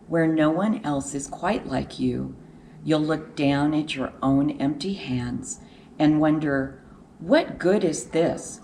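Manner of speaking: plain reading voice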